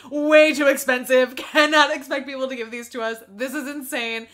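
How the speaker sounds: loud mock indignation; retold indignant outburst. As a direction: exclaim it in exaggerated outrage at the price. Retelling own shocked outburst.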